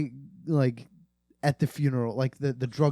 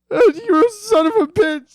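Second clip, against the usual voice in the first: much higher and louder, a half-sobbing outburst; anguished yelling curse; 8 dB louder than usual. Acted. A friend cries out at the dealer, a high, loud, sobbing yell. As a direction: scream it out like a grieving friend who just found the dealer. Acted quarrel, friend dead.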